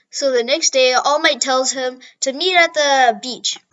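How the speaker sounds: ordinary talk, nothing marked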